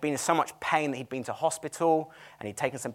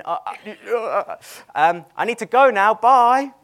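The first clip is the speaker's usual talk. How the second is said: flustered, getting louder and higher into a rushed goodbye; flustered exit; 8 semitones higher, 9 dB louder, slower than usual. Retold or imitated. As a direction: act out the panicked escape, building up. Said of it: "I was like".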